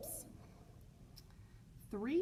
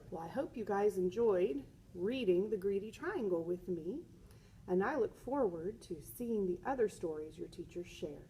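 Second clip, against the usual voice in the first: ordinary delivery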